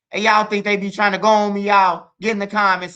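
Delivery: high, loud appeal; loud excited appeal to viewers; calling to viewers. Real, not acted. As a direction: call out to the audience loudly.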